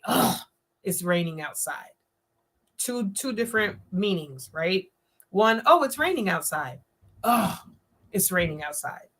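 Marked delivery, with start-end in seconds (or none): 0.0-1.9 groan, then a softer, lower, fed-up line; annoyed. Shown as a demonstration. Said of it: "It's not what you said, it's how you said it". 7.2-9.1 groan, then a lower, softer, fed-up line; upset. Shown as a demonstration. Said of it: "The other one is like, "Oh man, she's upset 'cause it's raining outside".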